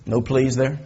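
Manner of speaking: usual speaking voice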